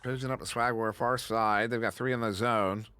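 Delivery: quick, lively sportscaster call; live play-by-play. Acted. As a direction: call the action as it happens.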